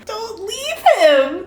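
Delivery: a desperate reader begging the character in a high, slow wail. Real, not acted. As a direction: beg in a high, slow wail, rising at the end.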